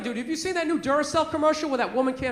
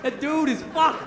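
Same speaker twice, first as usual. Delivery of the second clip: ordinary talk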